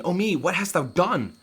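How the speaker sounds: high, loud acted outcry; horrified queen. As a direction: cry out aghast at the deed. Queen witnessing Hamlet kill Polonius.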